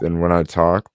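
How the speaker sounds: usual speaking voice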